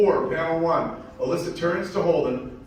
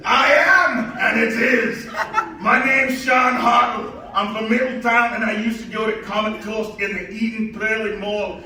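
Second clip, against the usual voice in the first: put-on loud, high Scottish accent; Scottish brogue; 7 semitones higher, 5 dB louder than usual. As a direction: keep the thick Scottish brogue going. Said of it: "his thickest Scottish brogue".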